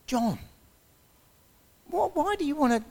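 high, startled plea as the news sinks in; alarmed disbelief. Retold or imitated. Driving a suicidal passenger, asks him why in high shocked disbelief. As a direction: voice jumps way up in shock.

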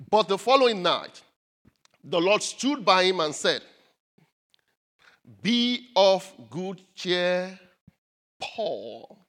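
slow, deliberate Bible reading; scripture reading. Acted. Preacher reading the verse to the church, slow and solemn. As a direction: read the verse with weight, drawing it out.

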